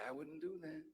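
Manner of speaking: hushed, gentle voice of conscience; soft inner voice. Acted. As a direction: murmur it like a quiet inner warning. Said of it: "the messenger of God within will say".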